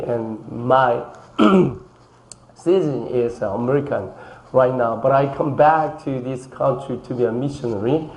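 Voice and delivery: slow, halting English of a Korean-American adoptee; Korean-American accent. Pretend. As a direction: play an American missionary speaking slowly. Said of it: "저는 미국에서 왔습니다". (느리고 또박또박한 교포 영어 억양)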